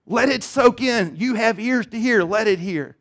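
lightly voiced inviting Jesus. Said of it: "this invitation that says".

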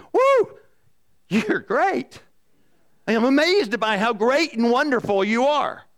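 high, loud Woo, then overdone cheerful praise; hammed-up cheering for a bad shot; exaggerated fake encouragement; mock gushing praise. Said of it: "hit a golf ball bad and go".

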